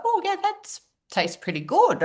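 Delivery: pleasantly surprised by how good it tastes. Retold or imitated. First taste of the juice.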